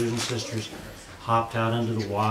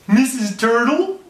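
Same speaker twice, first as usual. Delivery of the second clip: high, loud and slow, mouth stretched wide; a baby frog asking Missus Cow, wide-mouthed, high and loud; 12 semitones higher, 7 dB louder, slower than usual. A narrator's reading. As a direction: stretch every word out like a frog with a huge grin. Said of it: "that's the way they talked".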